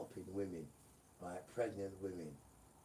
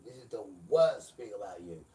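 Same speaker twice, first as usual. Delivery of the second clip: the word worst hammered much louder; loud repeat; 12 dB louder than usual. Shown as a demonstration. Preacher shouting at the congregation, repeating the line loud.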